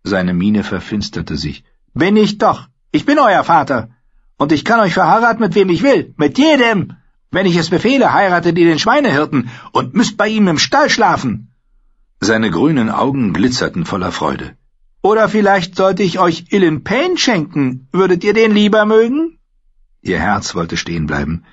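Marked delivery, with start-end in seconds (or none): 1.9-11.5 put-on higher, louder taunting boy king, faster in places; gloating cruel king. A narrator's reading. King Joffrey to Sansa, gloating and taunting. 15.0-19.4 put-on higher, louder taunting boy king, faster in places; gloating cruel king. A narrator's reading. King Joffrey to Sansa, gloating and taunting.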